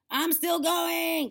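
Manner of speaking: high, slow, loud screech; screechy old woman. A narrator's reading. Grandma up in attic, screechy old voice. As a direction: screech it down from above. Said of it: "came the old screechy voice".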